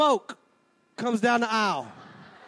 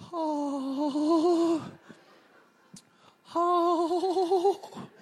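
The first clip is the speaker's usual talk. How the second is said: crowd cooing; 3 semitones higher, 3 dB quieter than usual. Retold or imitated. Wedding crowd cooing at flower girls.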